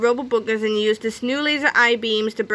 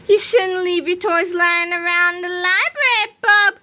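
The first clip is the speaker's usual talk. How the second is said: sly; 9 semitones higher, 5 dB louder than usual. A narrator's reading. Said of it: "George said slyly".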